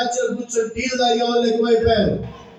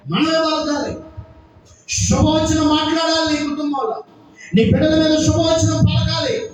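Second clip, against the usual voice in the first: high, insistent preaching; preaching exhortation; 6 semitones higher than usual. Acted. Preacher telling families to speak blessings, high exhorting voice. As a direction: urge each line on a high, sustained pitch.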